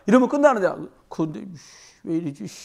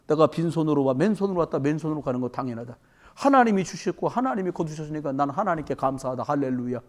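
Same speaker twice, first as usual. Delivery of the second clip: humble Job; 5 semitones lower, 5 dB quieter than usual. Acted. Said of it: "뭐라고 말합니까?".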